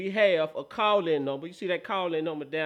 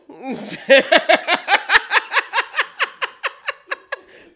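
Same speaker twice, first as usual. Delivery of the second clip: high loud burst of laughter; big open laugh; 14 semitones higher, 12 dB louder than usual. Real, not acted. Laughing after Castro.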